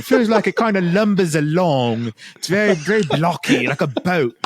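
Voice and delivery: bad mixed British, Australian and Jamaican accent; terrible British accent. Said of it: "it was a terrible accent".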